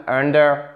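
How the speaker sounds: "under," stressed on UN, weak ending; first-syllable stress. Shown as a demonstration. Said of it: "accentuation sur la première syllabe". (« under, » accentué sur la première syllabe)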